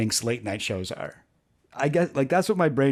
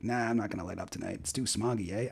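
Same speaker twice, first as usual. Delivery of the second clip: quick, much softer repeat of a Canadian punchline; quick Canadian requote; 4 semitones lower, 10 dB quieter than usual. Acted. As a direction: rattle off the mock Canadian line under your voice. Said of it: "That's, like, Canadian".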